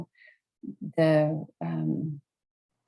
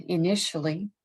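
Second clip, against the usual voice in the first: ordinary delivery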